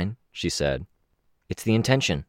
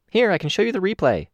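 higher, eager Kenzie voice; bright eager kid character, higher; 14 semitones higher than usual. Acted.

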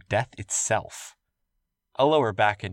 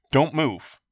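urgent command; 5 semitones higher, 5 dB louder than usual. Acted. William urging injured man.

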